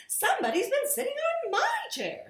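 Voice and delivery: high, fast and laughing Mrs Blair; high mother voice. A narrator's reading. Missus Blair finding her chair used, high and laughing. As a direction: rattle it off high with a giggle. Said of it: "said Missus Blair".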